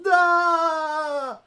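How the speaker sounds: long, loud high-pitched scream; fleeing scream. Acted. Game master voicing a character sprinting from a vision, long scream. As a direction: scream at full voice while running away. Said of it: "You start sprinting away".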